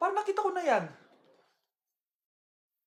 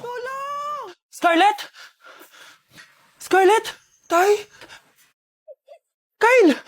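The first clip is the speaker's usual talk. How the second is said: cry for help; 6 semitones higher, 7 dB louder, much slower than usual. Acted. Child cries out for help.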